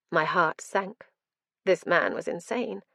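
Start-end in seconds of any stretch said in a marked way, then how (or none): none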